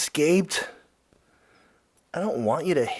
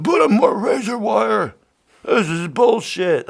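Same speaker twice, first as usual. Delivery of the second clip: mock shout, higher and louder; imitating hollering; 7 semitones higher, 8 dB louder than usual. Host mocking angry people at the Texas border, hollering. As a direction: ham up an angry border protester. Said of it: "they're all fucking hooting and hollering".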